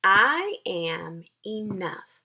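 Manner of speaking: slow, firm affirmation. Shown as a demonstration. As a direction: plant each word slowly and firmly. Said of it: "another affirmation you may have for yourself is".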